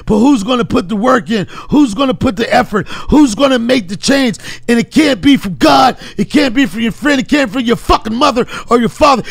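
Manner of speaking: relentless drill-yourself tirade, building in force; David Goggins impression. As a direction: punch every phrase like a self-berating pep talk. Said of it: "incredible David Goggins, uh, impression".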